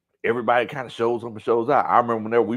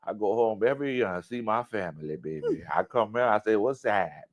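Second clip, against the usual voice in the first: softer, lower New Orleans accent impression; imitated New Orleans accent; 4 semitones lower, 6 dB quieter than usual. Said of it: "she had the heaviest New Orleans accent".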